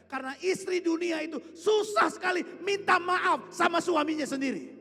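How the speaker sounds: high, very loud comic rant; loud, high-pitched outburst; exasperated shout. Real, not acted. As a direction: burst out shouting to the congregation. Preacher's comic outburst about wives.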